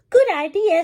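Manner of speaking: high, bright and eager; girl happily taking the wolf's advice; cheerful agreement. A narrator's reading. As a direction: say it with delight. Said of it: "said Little Red".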